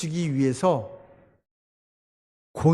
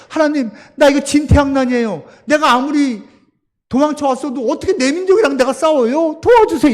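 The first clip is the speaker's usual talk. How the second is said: high, loud desperate cry to God; pleading prayer; 8 semitones higher, 6 dB louder than usual. Retold or imitated. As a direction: beg God for help, voice climbing. 다윗의 기도를 연기. (하나님께 매달리는 높고 큰 기도)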